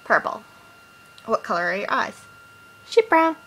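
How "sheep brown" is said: silly jokey yelp. Real, not acted. Joking answer about eye colour.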